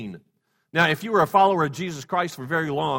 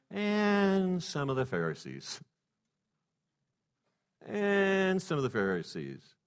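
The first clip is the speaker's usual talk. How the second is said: slow ominous; 3 semitones higher, 6 dB quieter, slower than usual. Real, not acted. Repeated slow ominous line.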